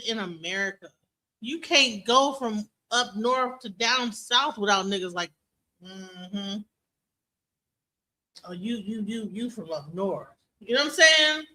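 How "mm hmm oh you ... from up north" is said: low-volume, knowing mm-hmm and remark; softly muttered mock suspicion; suspicious mock local. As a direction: imitate someone quietly sizing up a newcomer. Mimics suspicious locals sizing up newcomer.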